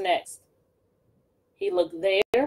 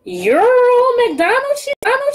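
Gritch's voice; 13 semitones higher, 9 dB louder than usual. Acted. Gritch confronting the farmer, high and loud. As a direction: screech it like a hungry witch. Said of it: "she said".

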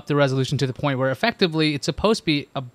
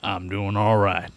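slow, lower Southern trucker drawl; Southern accent; 7 semitones lower, slower than usual. Acted. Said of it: "I have to have a Southern accent when I do that".

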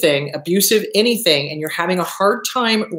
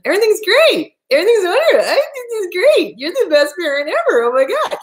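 high, bright-faked happy voice, much louder; fake cheerful; 10 semitones higher, 4 dB louder than usual. Mocking the only allowed emotion, fake chipper gushing. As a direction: gush with forced cheer.

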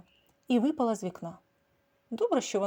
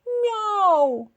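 very high, very loud yowl; a final desperate yowl; last loud meow; 12 semitones higher, 9 dB louder than usual. A narrator's reading. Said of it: "в останній раз закричала вона". (останнє відчайдушне нявкання)

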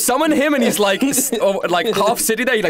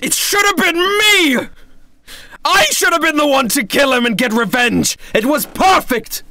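anguished outburst; 5 semitones higher than usual. Acted. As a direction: rage at the stolen revenge. Trevor grieving lost revenge.

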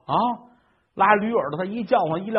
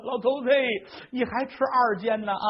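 mild near-tears; 6 semitones higher than usual. Acted. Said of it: "老太太都快哭了".

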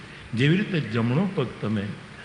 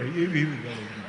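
ordinary talk, nothing marked

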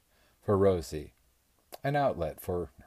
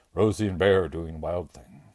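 thick-witted caveman grunt, a bit louder; a brute talking to himself about Rosie and the bear; dim strongman voice; 6 dB louder than usual. A narrator's reading.

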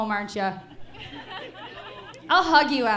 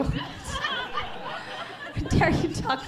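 high, very slow drawn-out squeal; hammed-up offense at being heckled; mock outrage; 13 semitones higher, 4 dB quieter, much slower than usual. Comic squealing mock offense at an audience member. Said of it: "mock offended at audience".

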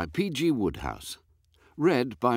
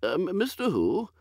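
put-on high, dithering old lord, higher and fluttery; a woolly, absent-minded aristocrat, higher pitched; vague old earl; 11 semitones higher than usual. Lord puzzling over dinner with his butler, high and dithery.

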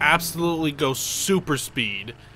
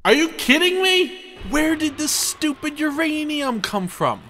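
exasperated outburst; 9 semitones higher than usual. Real, not acted. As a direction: vent at the game. Car wrecked, game frustration.

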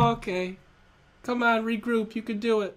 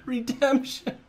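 ordinary delivery

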